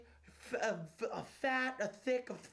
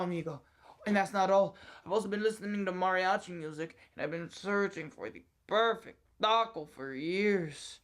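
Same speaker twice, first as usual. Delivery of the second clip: put-on Mexican accent. Employee unveils his practised accent to the boss, hammed-up Mexican accent. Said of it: "perfecting a Mexican accent".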